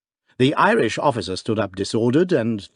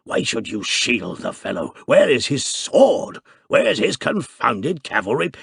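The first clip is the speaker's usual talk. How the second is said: angry father; 2 semitones higher, 3 dB louder than usual. Acted. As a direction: bluster at your daughter. Father bullies daughter.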